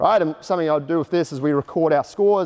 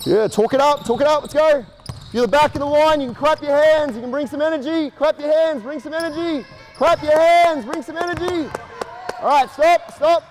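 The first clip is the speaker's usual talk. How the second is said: coach yelling cues; 9 semitones higher than usual. Real, not acted. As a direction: pump up the energy of the lines. What was I yelling out.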